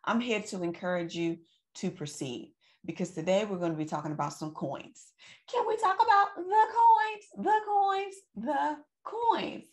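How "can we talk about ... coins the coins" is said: high excited sing-song call. Acted. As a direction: sing out about the coins, thrilled. Excited sing-song about coins.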